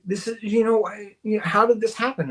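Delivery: mock-alarmed, searching for words; retold bewilderment. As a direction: imitate a confused girlfriend demanding answers. Retold girlfriend's alarmed question.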